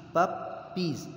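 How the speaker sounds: ordinary talk, nothing marked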